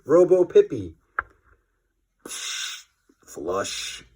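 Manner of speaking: ordinary delivery, nothing marked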